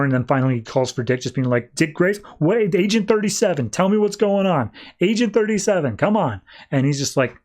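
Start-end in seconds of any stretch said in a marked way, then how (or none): 1.8-6.4 high, urgent pleading in a retold panic; freaking out. Agent One begs Dick for news, freaking out. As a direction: act out a frantic demand for answers. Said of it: "starts freaking out more and more".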